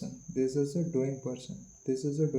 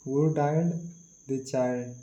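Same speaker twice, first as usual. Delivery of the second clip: usual speaking voice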